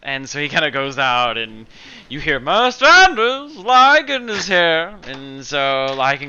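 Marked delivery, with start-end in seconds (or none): 2.4-5.0 high, loud, slow call to the master; raised high servant call. Acted. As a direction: announce him across the house. Boblin calls out to master.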